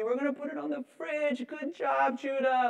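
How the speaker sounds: high, sing-song parent cheer; high, beaming parent voice praising a toddler's drawing; over-the-top praise. Retold or imitated. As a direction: hype up the little one. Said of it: "over the top, like, so happy".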